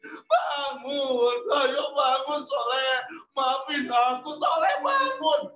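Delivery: tearful, rising cries of wake up; crying plea. Acted. Jami hugging Soleh, crying for him to wake. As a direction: sob out the plea to a friend who won't wake.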